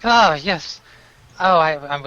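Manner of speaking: a loud, sloshed greeting; drunk. Acted. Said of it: "he's slurring his words".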